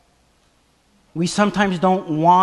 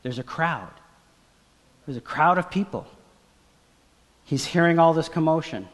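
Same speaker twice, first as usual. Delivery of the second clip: usual speaking voice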